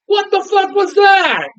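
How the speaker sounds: high, drawn-out incredulous cry; confused outrage. Retold or imitated. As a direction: wail it in total disbelief. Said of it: "I just sat there confused".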